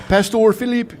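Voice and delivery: high, loud put-on Filipino accent. Shown as a demonstration. Guest demonstrating his impression for the host, Filipino accent. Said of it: "That's my Filipino accent".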